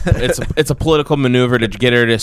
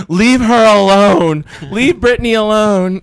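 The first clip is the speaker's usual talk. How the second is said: higher and louder, slow, hammed-up pleading; mock whiny defender; 7 semitones higher, 5 dB louder, slower than usual. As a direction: imitate friends crying for her to be left alone. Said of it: "they're like".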